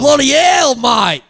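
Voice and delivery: high Aussie cry, dropping on the last word; hammed-up Australian exclamation; Australian accent. [name] swears at his mate in a high, hammed-up Australian accent. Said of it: "You're good at accents".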